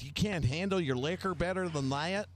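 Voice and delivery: high-pitched imitation of a Southern mom; southern lady voice. Friend's mom chiding him over his liquor, imitated southern lady voice. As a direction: scold lightly as a Southern lady. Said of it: "In that southern lady voice".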